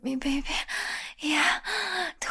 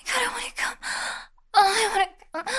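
much higher, strained moaning plea; breathless need; 9 semitones higher, 4 dB louder, much faster than usual. Acted. Lover near climax crying out, high strained moan. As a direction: let the need break out high and loud.

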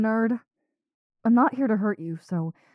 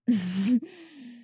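giddy laugh; 6 semitones higher than usual. Acted. Giddy after his answer.

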